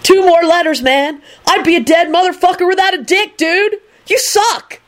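high, rising whiny complaint; aggrieved, sulky high voice. A narrator's reading. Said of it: "Kenny said sulkily".

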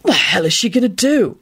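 hushed alarm, lightly done; understated fearful aside; frightened whisper. A narrator's reading. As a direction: ask it under mild panic. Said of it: "whispered to Rosie in a frightened voice".

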